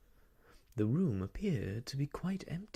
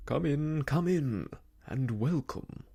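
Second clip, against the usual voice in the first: hearty welcome, high and loud; jovial, ringing invitation; loud, cheery call; 4 semitones higher, 5 dB louder than usual. A narrator's reading. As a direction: call out cheerily to visitors outside. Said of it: "called a loud, cheery voice from inside".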